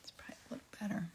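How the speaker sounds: breathy hushed self-talk; whispering. Real, not acted. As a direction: murmur it to yourself. Whispering to herself while painting.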